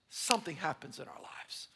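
whisper. Real, not acted. Preacher drops to hushed aside.

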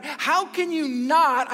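pulpit-pounding question; emphatic slow preaching. Real, not acted. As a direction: drive it home from the pulpit. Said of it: "preaching emphasis".

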